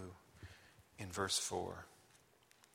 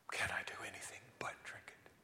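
fast soft whisper; a whispered inner question; 6 semitones lower, 8 dB quieter, faster than usual. Acted. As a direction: murmur it to yourself. Quiet whispered resolve.

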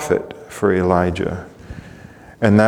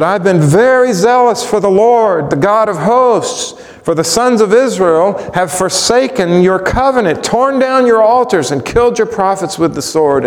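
impassioned plea; 8 semitones higher than usual. Acted. Elijah's impassioned complaint to God.

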